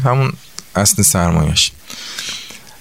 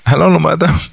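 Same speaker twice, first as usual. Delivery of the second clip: usual speaking voice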